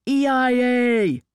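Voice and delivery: loud, high, drawn-out yell; slow shouted counter-challenge; yelling back. A narrator's reading. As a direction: yell your agency back at them. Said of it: "the others yelled back".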